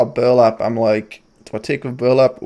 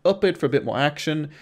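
voiceover narration; 6 dB quieter than usual. Acted.

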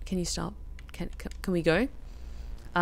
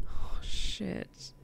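whisper; 2 semitones higher, 5 dB quieter than usual. Real, not acted. Whispered fright.

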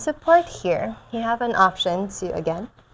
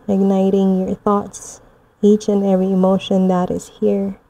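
guided meditation; 4 dB louder than usual. Acted. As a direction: guide them gently through the visualization. Guided moon visualization.

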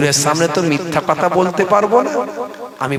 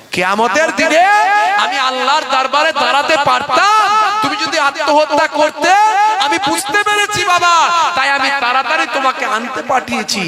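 huzur crying out; 8 semitones higher than usual. Acted. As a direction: let the dread break out high. Huzur's emotional outburst.

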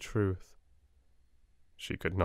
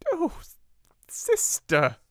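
sniffing; 4 dB louder, slower than usual. Acted. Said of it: "she sniffed".

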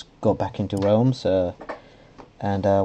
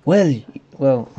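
louder failed celebrity impression; attempted Van Damme; 5 semitones higher, 6 dB louder than usual. Vlogger testing voices for the realm owner, failed impression. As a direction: try a put-on voice and give up. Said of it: "I can't do a Van Damme".

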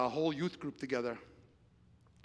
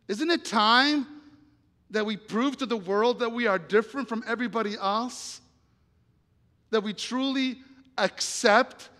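loud, raised, driving sermon voice; impassioned preaching; 7 semitones higher, 9 dB louder than usual. Real, not acted. Preacher challenges the church to love people, impassioned. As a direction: press each rhetorical question hard on the congregation.

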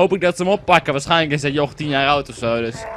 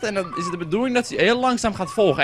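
ordinary delivery

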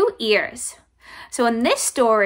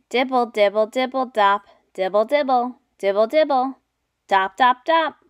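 louder rhythmic dibble dop; rain sounds; 5 dB louder than usual. Shown as a demonstration. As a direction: patter it out like rain. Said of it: "He can sound like the rain".

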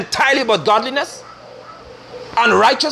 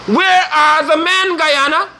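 calling out; 5 semitones higher, much slower than usual. Real, not acted. Preacher's appeal to the nation.

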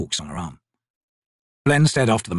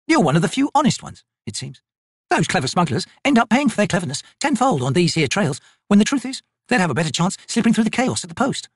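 higher, brisk soldier woman's voice; Picker, tough soldier; 7 semitones higher than usual. Acted. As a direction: voice the corporal, dry and sure of herself. Picker's voice in dialogue.

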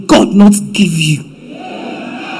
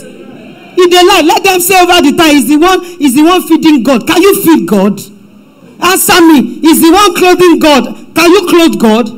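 raised, building preacher's voice; preaching; 6 semitones higher than usual. Real, not acted. Pastor rebuking the congregation, raised preaching voice.

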